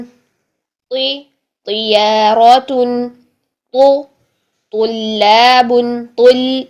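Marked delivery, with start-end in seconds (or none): 1.7-3.1 तियारतुन very slow with a heavy full-mouthed त; drawn-out तियारतुन, त thick from the back. Shown as a demonstration. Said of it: "मुंह भर के ही अदा करेंगे". 4.7-6.0 तुल्लाबुन slowly, heavy त, thin light ल; तुल्लाबुन with a thick त and a thin ल; full त, thin ल. Shown as a demonstration. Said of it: "लाम आपका मोटा नहीं होना चाहिए".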